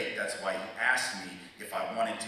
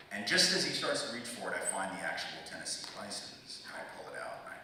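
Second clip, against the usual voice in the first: usual speaking voice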